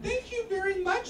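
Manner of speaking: high-pitched, put-on politeness; mock-polite thanks. Comic answers the cookie guy, mock-polite.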